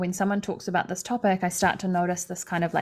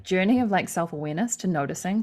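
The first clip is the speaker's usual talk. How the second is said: normal talking voice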